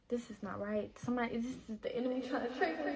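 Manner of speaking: quick hushed murmur of growing suspicion; hushed inner warning. Retold or imitated. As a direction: mutter it to yourself as if sensing a trick. Scared inner voice, seeing the enemy.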